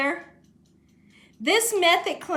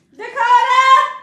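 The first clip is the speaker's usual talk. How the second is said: loud drawn-out call across the house; 9 semitones higher, 8 dB louder, slower than usual. Real, not acted. Mom calling Dakota from the other room, loud. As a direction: shout for Dakota to come.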